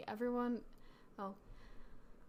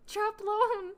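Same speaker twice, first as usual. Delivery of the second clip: loud, high announcement; announcing; 10 semitones higher, 8 dB louder than usual. Acted. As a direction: call out the house name. Announcing sorting result aloud.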